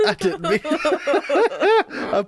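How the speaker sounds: laughing through the words, slow and high. Real, not acted. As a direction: giggle it out.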